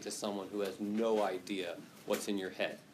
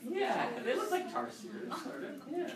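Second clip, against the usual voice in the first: laughing; 13 semitones higher than usual. Real, not acted. Students pull up bush baby picture.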